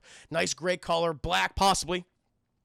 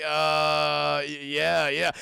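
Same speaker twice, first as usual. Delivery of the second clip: low, loud drawn-out dopey mumbling; not caring; 4 semitones lower, 7 dB louder, much slower than usual. Retold or imitated. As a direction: imitate a distracted man half-listening. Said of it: "He does not care".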